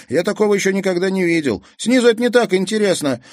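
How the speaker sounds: put-on high, fast eager boyish voice; boy who cannot stop looking; excited boy. Kazik excited by storm. (восторженно и быстро)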